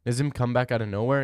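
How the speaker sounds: ordinary talk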